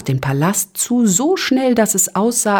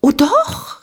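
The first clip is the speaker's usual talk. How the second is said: very high, keen little squeak; tiny host chirping with zeal; eager cry; 12 semitones higher than usual. A narrator's reading. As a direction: pipe up eagerly. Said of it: "rief Ping-Pong eifrig".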